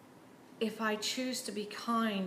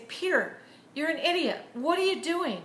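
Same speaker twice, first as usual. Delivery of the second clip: mock scolding; 8 semitones higher, 8 dB louder than usual. The scolding Jesus never gave.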